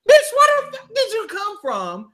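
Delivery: high, loud, outraged retort; shrill-free mock outrage; indignant outburst. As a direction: let her have it, outraged and loud. Ranting about a woman cutting in line.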